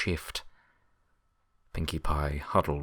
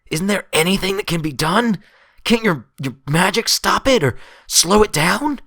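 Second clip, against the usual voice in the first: much higher, much louder desperate cry; desperate pleading; 11 dB louder than usual. Acted. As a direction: beg like the last hope is slipping away. Dash begs for a cure.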